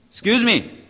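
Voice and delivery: "Excuse me" said high and loud; loud "Excuse me" to his wife. Retold or imitated. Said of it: "What do you say?".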